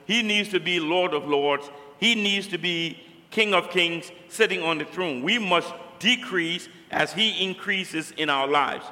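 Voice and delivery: ordinary delivery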